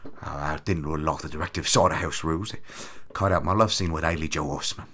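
a lightly done aggrieved Caine; Michael Caine impression. Caine complaining about his cut scene, low and soft. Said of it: "I'm working on my Michael Caine".

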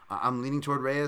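ordinary talk, nothing marked

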